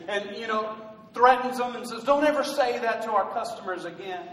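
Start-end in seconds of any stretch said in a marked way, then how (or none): none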